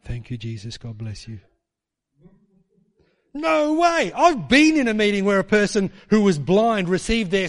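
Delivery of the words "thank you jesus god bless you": low, soft, underplayed thanks; comically composed, barely any voice; deadpan politeness, dropped low and quiet; mock calm. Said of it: "Are you just gonna stay calm?".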